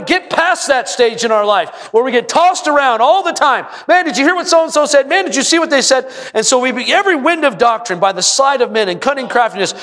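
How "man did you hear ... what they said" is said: gossipy excitement. Retold or imitated. Voicing gossiping churchgoers.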